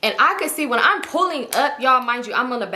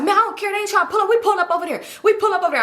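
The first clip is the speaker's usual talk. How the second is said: high-pitched irritated insistence; 8 semitones higher, faster than usual. Retold or imitated. Arguing with cousin about pulling up, irritated. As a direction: insist in a raised pitch, fed up. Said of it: "I'm irritated".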